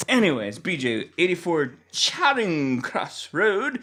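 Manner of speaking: ordinary talk, nothing marked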